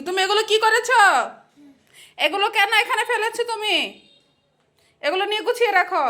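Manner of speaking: high-pitched chiding of a little one. Real, not acted. Mother to toddler who scattered things, scolding.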